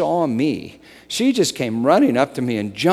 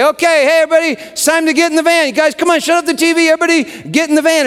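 high, loud, fast hurry-up call; a dad hollering through the house; 9 dB louder, much faster than usual. Retold or imitated. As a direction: round up the kids for the van. Dad calls family to the van.

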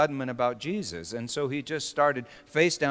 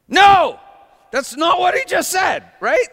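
mock outraged shout; 10 dB louder than usual. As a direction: shout it high and loud in protest. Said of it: "Did you hear this?".

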